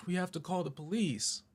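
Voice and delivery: high-pitched imitation of mom; mom's fateful pronouncement. Mom tells the driver about the police, high insistent voice. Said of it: "then she says the fatal thing".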